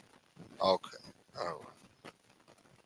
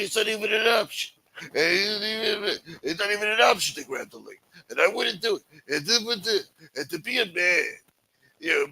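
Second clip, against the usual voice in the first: put-on higher, pleading old mobster voice; Chris Colombo impression; 7 semitones higher than usual. Imitating Chris Colombo pleading with Michael, in a higher pleading voice. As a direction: plead like a wounded godfather. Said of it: "Chris Colombo was calling him".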